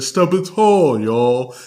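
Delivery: tall drawn long and falling, y'all tacked on; stretched words. Retold or imitated. Podcaster showing how [name] stretches tall. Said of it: "I like the way that he stretches".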